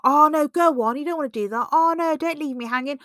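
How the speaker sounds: high, loud wheedling insistence; pushy coaxing friend. Retold or imitated. Friend pressing you into cake, loud high coaxing. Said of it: "I'm like".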